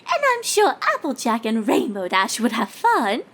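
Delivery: lightly voiced refined lady; a hint of posh mare; Rarity voice. A narrator's reading. Rarity character voice.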